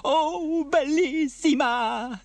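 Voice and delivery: high, loud, drawn-out rapture; Bella, overjoyed sigh. Acted. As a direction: gush adoringly over a returned pet. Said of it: "sighed Bella as Rosa purred".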